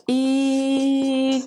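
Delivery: идём with a long, level first vowel; sounding out slowly. Shown as a demonstration. Teacher writing out "nous allons", drawn-out syllables.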